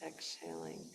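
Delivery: soft, slow breathwork voice; guided meditation. Shown as a demonstration. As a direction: guide them gently into the breath.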